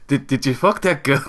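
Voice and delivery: botched Scottish accent that lands nearer Indian; bad Scottish accent. Shown as a demonstration. Cat's line redone on request, shaky-aimed Scottish accent. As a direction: attempt an evil Scottish voice and miss. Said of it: "Do the accent".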